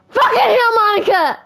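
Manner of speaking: loud high shaken curse; shocked yelling. Real, not acted. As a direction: yell it after a real scare. Reacting to jump scare.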